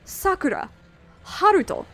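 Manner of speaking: Sakura and Haruto high on the first syllable; SA-kura, HA-ruto, stressed like Canada. Shown as a demonstration. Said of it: "the same way you pronounce Canada".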